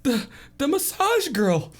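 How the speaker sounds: high falsetto, stammered start; comic high-pitched alarm; shaken Moo. Acted. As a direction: report it in a squeaky, rattled voice. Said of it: "he says".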